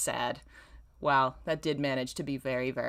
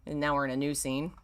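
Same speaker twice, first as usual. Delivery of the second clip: normal talking voice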